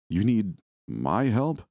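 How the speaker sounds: slow, disbelieving question with a lift; incredulous. Acted. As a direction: drag it out, not believing what you hear.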